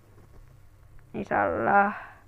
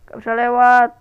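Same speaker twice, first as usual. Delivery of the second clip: loud exasperated cry; frustrated shout; 3 semitones higher, 10 dB louder than usual. Real, not acted. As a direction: yell at being blocked. Stuck, frustrated in game. (teriakan kesal karena tidak bisa lewat)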